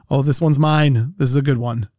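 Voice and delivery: usual speaking voice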